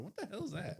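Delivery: high, startled what-the-hell; mock surprise. Retelling Fatu hearing Solo say his line, mock startled. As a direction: pitch it up in mock disbelief. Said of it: "Fatu's like".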